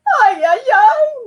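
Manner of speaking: high loud wailing sobs; mother sobbing with relief; sobbed. Acted. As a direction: wail through tears. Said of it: "Mama sobbed".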